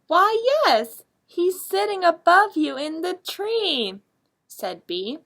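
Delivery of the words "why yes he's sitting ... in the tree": much higher, louder bright firefly voice; little firefly voice. Acted. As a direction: squeak it out high like a tiny bug. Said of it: "and Bee says".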